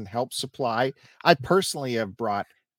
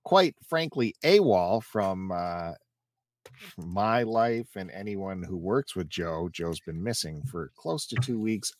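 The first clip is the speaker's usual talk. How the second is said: normal talking voice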